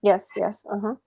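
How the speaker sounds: ordinary delivery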